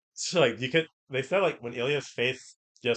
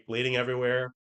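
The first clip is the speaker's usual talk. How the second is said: ordinary talk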